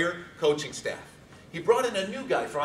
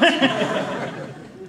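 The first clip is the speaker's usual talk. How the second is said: embarrassed laugh; 10 semitones higher, 7 dB louder than usual. Real, not acted. Laugh after apology.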